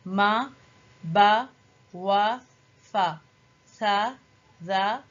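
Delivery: slow classroom letter recital; letter drill recitation. Shown as a demonstration. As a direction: sound out every letter clearly for the children. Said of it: "اس تصویر میں آپ دیکھ سکتے ہیں کہ حروف زبر کے ساتھ".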